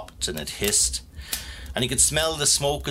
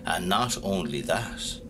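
ordinary delivery, nothing marked

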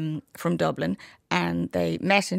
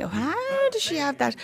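high-pitched mock astonishment; imagined surprised listener; 11 semitones higher than usual. Guest voicing a listener puzzled by her song choice, surprised. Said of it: "I know, you're saying".

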